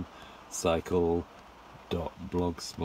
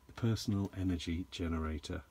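ordinary delivery

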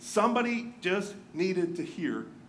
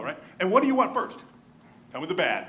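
ordinary delivery

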